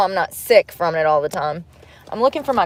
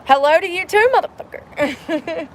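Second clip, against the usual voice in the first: high, mocking retort, slowing on the last word; sarcastic comeback; 9 semitones higher than usual. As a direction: fire back a sarcastic, sing-song insult. Sarcastic retort to rude manager.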